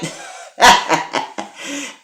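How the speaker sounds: airy high laugh; breathy laugh. Real, not acted. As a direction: laugh out in delight. Laughs at the pipe joke.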